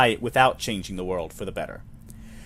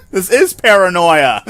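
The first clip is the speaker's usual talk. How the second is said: much louder, slow, laughing exclamation; laughing shout; 6 semitones higher, 12 dB louder, slower than usual. Real, not acted. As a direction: cry it out while laughing. Player laughs at the twist.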